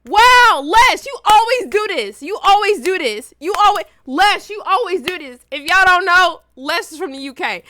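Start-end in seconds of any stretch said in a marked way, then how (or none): 0.1-5.4 high, loud theatrical protest; mock-indignant complaint. Accusing a friend of always leaving, loud mock hurt. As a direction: play up being wronged.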